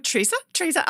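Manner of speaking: retold questioning call; therapist calling out. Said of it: "And she was going".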